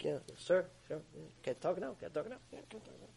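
very soft, submissive murmur; hushed, deferential. Acted. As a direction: keep it down, the boss is right there. Servant speaking with boss next to him.

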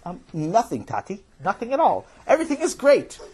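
climbing into a high, too-cheery voice; nervous cover-up. Acted. Boys hiding a fight from father, nervously high. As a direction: reassure unconvincingly, pitch rising as you go.

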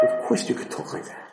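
whispered, voiceless reassurance; hushed rebuttal. Retold or imitated. Teacher answering a girl who says you can't, whispered. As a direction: drop to a whisper to counter the objection. Rebutting the girl's shocked refusal.

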